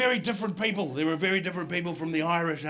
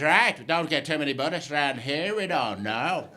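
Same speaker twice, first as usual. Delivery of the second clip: put-on Northern Irish cabbie accent; 6 semitones lower than usual. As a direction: answer as a puzzled Belfast driver. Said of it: "the inflection of his accent".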